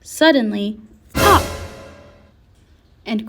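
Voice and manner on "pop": sudden high, loud pop; imitated pop. A narrator's reading. Narrator sounding the bandito's bursting, an explosive pop. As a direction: burst the word out abruptly.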